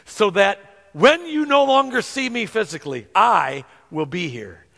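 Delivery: usual speaking voice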